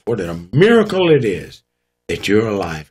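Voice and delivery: ordinary delivery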